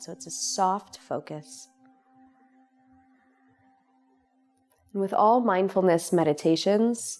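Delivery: calm, unhurried meditation voice with long pauses; guided meditation. Real, not acted. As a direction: guide them gently into relaxation, leaving space between phrases. Leads a guided relaxation meditation.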